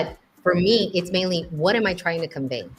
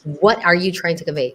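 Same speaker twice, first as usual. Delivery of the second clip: ordinary talk, nothing marked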